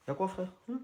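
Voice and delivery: usual speaking voice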